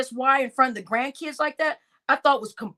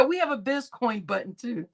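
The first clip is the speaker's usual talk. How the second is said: usual speaking voice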